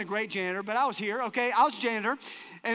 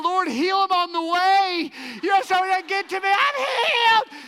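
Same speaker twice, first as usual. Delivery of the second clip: high, loud, strained mock-panicked praying, stretching the last words; desperate prayer; 8 semitones higher, 5 dB louder than usual. Janitor begging God to heal the man before he arrives, high panicked plea. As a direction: plead with God in rising panic.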